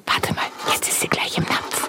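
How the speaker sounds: hushed whisper while hiding. Acted. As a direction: whisper so the mouse won't hear. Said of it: "wir verhalten uns ganz ruhig".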